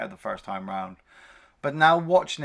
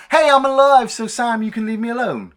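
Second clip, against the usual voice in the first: mock Peter; 10 semitones higher, 8 dB louder than usual. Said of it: "shoot Molly and go".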